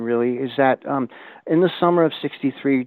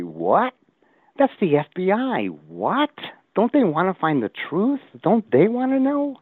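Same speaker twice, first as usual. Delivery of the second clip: rising pitch, each question climbing higher, mock astonished; incredulous disbelief; 9 semitones higher than usual. Host retells his disbelief at the FBI, climbing higher. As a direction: sound more and more disbelieving, ending high and slow.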